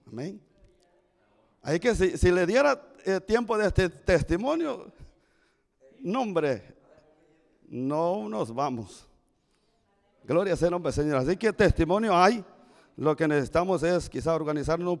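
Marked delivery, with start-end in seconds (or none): none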